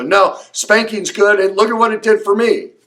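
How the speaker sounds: defensive parent. Retold or imitated. Imitating a viewer who defends spanking, indignant. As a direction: throw back the angry objection with mock heat. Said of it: "you get angry and go".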